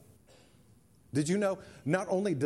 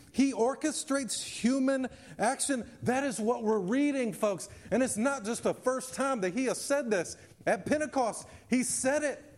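fervent preaching; 7 semitones higher than usual. Acted. Preaching at full force.

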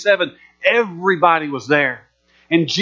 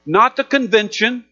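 slow, higher proclaiming cry; preaching; 4 semitones higher than usual. Acted. Jesus cries out to the crowd, proclaiming slowly. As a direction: lift the verse like a call to the crowd.

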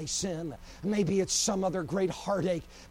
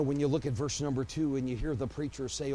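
ordinary talk

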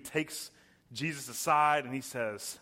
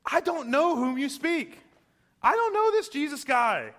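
much higher, loud, cursing outburst; swearing denial; 12 semitones higher, 6 dB louder than usual. Retold or imitated. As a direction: spit out the denial in a panic. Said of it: "began to invoke a curse on himself and to swear".

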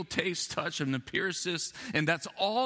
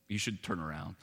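angel's quiet hint; 8 semitones lower, 5 dB quieter than usual. Retold or imitated. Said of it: "maybe one of them went".

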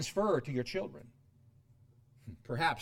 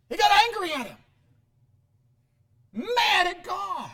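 incredulous outcry; 13 semitones higher, 8 dB louder than usual. Real, not acted. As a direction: cry it out in preacher's disbelief. Preacher dramatizes Cain's anger at God.

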